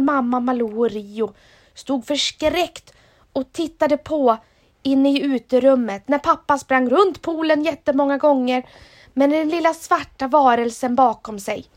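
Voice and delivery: ordinary delivery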